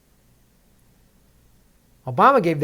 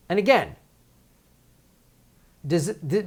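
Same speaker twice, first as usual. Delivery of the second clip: ordinary delivery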